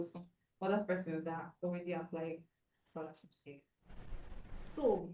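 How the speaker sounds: far from the mic, barely audible; faint voice. Real, not acted. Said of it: "Your voice is very faint".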